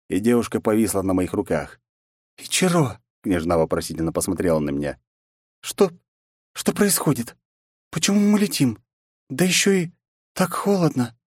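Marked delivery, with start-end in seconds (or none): 2.4-3.0 high, questioning princess. Acted. Princess calls hero. 5.6-11.2 put-on high, bewildered young woman; confused princess.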